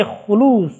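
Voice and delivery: خلوص said slowly, the واو stretched into a long open oo; drawn-out open vowel. Shown as a demonstration. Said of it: "خلوص میں آپ واو کو کھل کر پڑھ سکتے ہیں".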